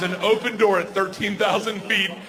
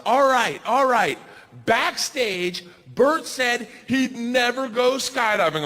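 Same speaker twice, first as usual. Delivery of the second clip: raised, stretched-out stage announcer call; announcing to a crowd; 3 semitones higher, slower than usual. Retold or imitated. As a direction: imitate a host working up the crowd.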